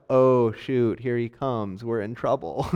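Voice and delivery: dropped low and soft, a worried aside; sheepish under-the-breath groan; nervous mutter. Retold or imitated. Disciples seeing Jesus coming, in a soft worried mutter.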